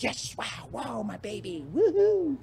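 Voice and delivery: a hint of hammed-up excitement; mock gleeful cheer. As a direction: pretend to gloat over a new truck. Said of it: "I wasn't like".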